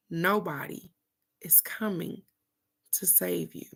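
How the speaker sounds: soft, spaced out phrase by phrase; hushed repetition. Retold or imitated. Speaker repeating cousin's lesson to viewers, hushed and deliberate. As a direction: let it sink in quietly, with pauses.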